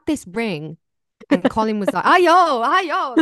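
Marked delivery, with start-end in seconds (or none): 2.0-3.2 high, loud alarmed shout; alarmed call. Retold or imitated. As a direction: call out in a panic about the ring. Colin freaking out about ring.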